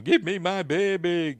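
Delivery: high, loud, slow cry of a desperate mother; hammed-up screaming demand for the baby; mock scream. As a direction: shriek it out as if begging for a child back. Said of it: "She's screaming at him".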